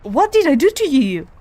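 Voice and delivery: loud, high outburst with DID leaned on; real frustrated shout. Real, not acted. Player pleading with the boss attacking her, loud outburst. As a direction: yell at the screen in disbelief.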